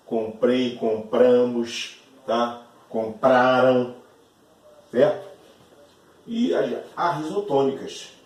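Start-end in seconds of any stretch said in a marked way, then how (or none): 0.8-4.0 compramos and Compraram drawn out slowly and louder; slow, loud classroom demo of compramos and Compraram; stretched conjugation. Shown as a demonstration. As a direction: drag out compramos and Compraram to show the endings. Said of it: "verbo comprar".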